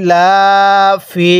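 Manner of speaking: ইলাফি read very slowly, each long vowel held one alif; slow tajweed-style elongation of ইলাফি; drawn-out recitation. Shown as a demonstration. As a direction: stretch ইলাফি into a slow Quranic chant. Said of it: "এক আলিফ টেনে পড়তে হবে".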